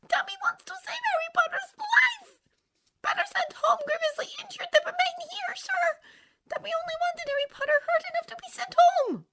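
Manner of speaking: very high, whispery house-elf squeak; a tiny frantic elf pleading; Dobby voice. A narrator's reading. Tearful house elf begging Harry Potter, high and whispery.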